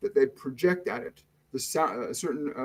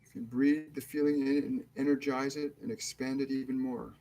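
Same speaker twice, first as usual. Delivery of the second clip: guided meditation; 4 dB quieter than usual. Acted. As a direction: ease them into calm, unhurried and hushed. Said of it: "we're going to start the meditation now".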